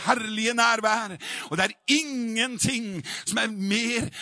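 high, loud revival preaching, easing slightly towards the end; full-force preaching. Acted. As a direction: drive it home to the congregation at full voice.

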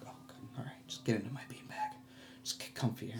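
soft, hushed self-talk; whispered to himself. Retold or imitated. In a room of sleepers, hushed to himself. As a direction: whisper so nobody wakes. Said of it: "I'm like".

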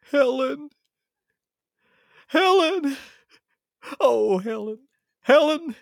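very high, loud wail of the name; hammed-up anguished cry. Husband calling Helen into the mirror, hammed-up anguished cry. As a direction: cry out in mock despair, pitch soaring. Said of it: "he utters the immortal line".

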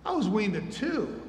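drawn-out, singsong mockery; mocking. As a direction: imitate an older brother teasing a toddler. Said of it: "Maybe he was mocking him".

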